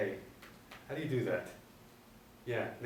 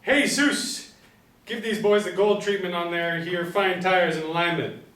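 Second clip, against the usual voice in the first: loud high call across garage; 6 semitones higher, 7 dB louder than usual. Acted. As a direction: holler to the mechanic. Fletch calls to mechanic.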